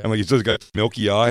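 ordinary delivery

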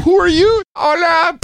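high squeaky put-on greeter voice; high mock voice. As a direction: squeal it like a silly host. Mock greeter voice at sex island.

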